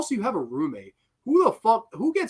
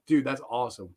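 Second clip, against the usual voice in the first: ordinary talk, nothing marked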